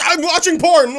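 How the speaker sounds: high, loud blurt; blurted confession. Retold or imitated. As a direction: blurt it out guiltily. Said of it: "You're like".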